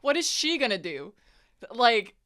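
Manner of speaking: loud, high, worked-up chatter; excited reaction. Real, not acted. Player reacting to shocking overheard plot, loud and keyed up. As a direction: let the shock spill out fast.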